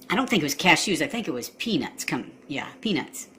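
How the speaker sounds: husband mumbles about peanuts, low and soft; mumbled aside. Acted. Husband dodges into nut details.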